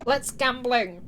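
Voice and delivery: loud playful meme catchphrase; excited shout. Pretend. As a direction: belt out the catchphrase.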